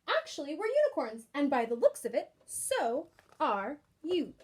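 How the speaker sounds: ordinary delivery, nothing marked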